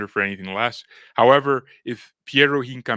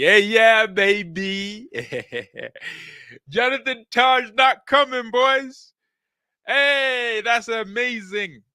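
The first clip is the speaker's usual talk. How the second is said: excited; 12 semitones higher, 6 dB louder, slower than usual. Real, not acted. Celebrating transfer news to chat.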